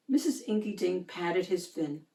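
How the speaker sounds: ordinary delivery, nothing marked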